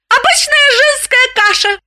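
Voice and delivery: high, proud declaration. Acted. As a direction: announce it with pride. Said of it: "с гордостью сообщила Галина".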